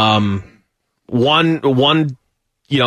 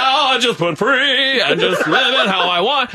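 high, lilting imitation with laughter; 7 semitones higher than usual. Host mimicking his friend who just went free, flamboyant. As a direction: play the liberated friend, high and hammy.

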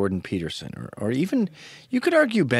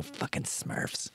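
very soft, slow grumble under the breath; mock a bitter hater muttering to himself; hushed, drawn-out mock grumble; spiteful mutter; 12 dB quieter, much slower than usual. Voicing the spiteful writers grumbling.

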